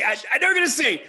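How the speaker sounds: high, fast laughing burst; laughing excitedly. Real, not acted. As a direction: blurt it out through laughter.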